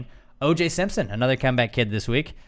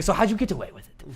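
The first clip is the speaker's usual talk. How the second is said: higher-pitched sly question; prodding interviewer; 6 semitones higher than usual. Retold or imitated. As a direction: imitate a comic fishing for a confession.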